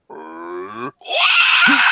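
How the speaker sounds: mock a face with a groan and squawk; imitated wail. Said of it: "He's like".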